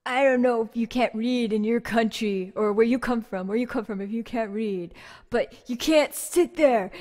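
loud, slurred mock rant at a stranger; drunk guy yelling. Drunk man on the bus scolding Laura, loud. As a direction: play the hostile drunk on the bus. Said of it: "he starts staring at me and he's just like".

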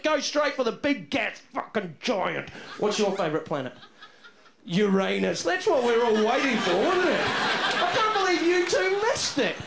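ordinary talk

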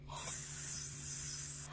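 whispered hiss; soft sustained snake hiss. Acted.

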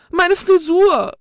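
high, loud dismayed cry; cries out. A narrator's reading. Sylvania crying out as holy water ruins her hair, high and loud. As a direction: shriek at the ruined hair. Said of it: "rief Sylvania spontan".

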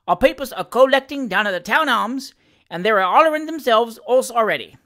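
lively dialect servant reporting with relish; Sam Weller, cheeky manservant. Acted. Valet telling his master of the election, animated Cockney.

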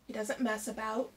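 usual speaking voice

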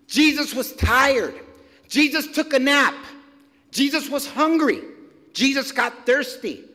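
preaching at full force. Acted. Preacher building a litany to the congregation, emphatic.

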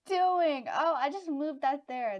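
loud, high blurt at own blunder; startled dismay. Real, not acted. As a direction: cry out as the mistake sinks in. Realises a bad game move.

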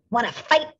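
high, loud challenge; grouchy ladybug. A narrator's reading. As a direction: spoil for a fight. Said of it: "said the grouchy ladybug".

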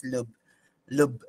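lub said short with a closed u; "lub" with a short u, as in put. Shown as a demonstration. Teacher showing how to say the heart sound "lub". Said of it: "What I care here is the word lub".

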